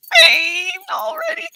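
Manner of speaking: very high, loud, laughing cry; shrieking through laughter. Real, not acted. Watching her clip with viewers, shrieking laughter.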